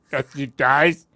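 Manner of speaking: badly faked Russian villain, sounding like a muppet; strangled, Kermit-like Russian accent; attempted Drago. Shown as a demonstration. Attempting the Drago quote, muppety Russian voice. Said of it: "You sound like Kermit".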